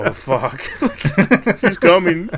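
high, giggly pretend fright; hammed-up dread breaking into laughter; mock panic, laughing. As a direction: laugh through a playfully scared warning. Acting scared child, laughing.

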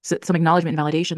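ordinary delivery

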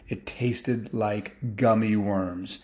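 usual speaking voice

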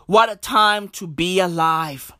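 ordinary talk, nothing marked

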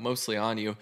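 normal talking voice

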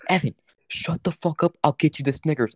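high, exasperated classmate; annoyed whisper-scold. Retold or imitated. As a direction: hush him up impatiently. Girl scolds him.

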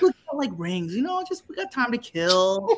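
slightly too breezy excuse; playing it cool. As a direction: act casual while covering up. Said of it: "try to play it off cool".

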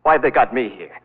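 building from tense to loud, high panic with a wild laugh; rising hysteria. Acted. Terrified patient rants to Lamont about his uncle, building hysteria.

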